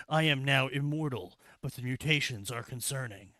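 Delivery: mock journal reading. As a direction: read it like an unhinged horror game log. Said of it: "in true Resident Evil fashion".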